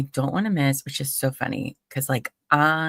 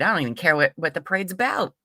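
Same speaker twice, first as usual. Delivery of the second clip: ordinary delivery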